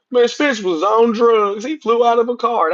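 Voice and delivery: slow, scoffing imitation of haters; mocking the critics. Streamer imitating critics on Spence, high and slow. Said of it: "They gonna make it seem like".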